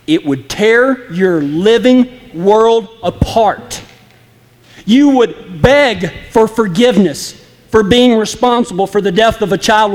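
usual speaking voice

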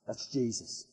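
almost breathe it, stunned to recognize him; hushed recognition. Retold or imitated. John recognizing Jesus in awe.

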